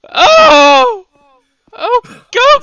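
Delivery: panicked high screaming; man down shout. Acted. Judge sees man collapse.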